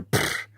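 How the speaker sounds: short blown-out sputter through the lips; imitated speaker pop. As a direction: mimic a sub blowing with a puff. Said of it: "I hear".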